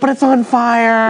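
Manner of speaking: high, drawn-out imitation of a friend's excuse; imitating a dawdler's slow protest; mock protest. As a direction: stretch it out like a pleading excuse. Voicing Gary's excuse for stopping.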